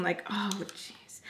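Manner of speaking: breathless whispered oh, jeez; whispered aside. Real, not acted. Annoyed makeup artist whispering to herself about the client. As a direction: whisper it to yourself in exasperation. Inner reaction to rude client.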